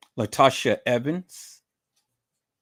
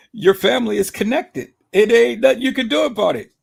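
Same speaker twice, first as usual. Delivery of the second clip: taunt; 6 semitones higher, 6 dB louder, faster than usual. Real, not acted. Taunting the rival with a repeat.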